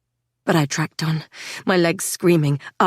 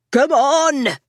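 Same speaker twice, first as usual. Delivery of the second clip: begging; 7 semitones higher, 3 dB louder than usual. A narrator's reading. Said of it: "Scotty begged".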